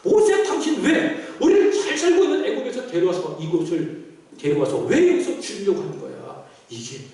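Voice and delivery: indignant grumble, pitch jumping up; hammed-up resentful demand; acted complaining. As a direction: protest like a fed-up crowd. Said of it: "불평했어요".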